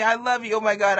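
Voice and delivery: normal talking voice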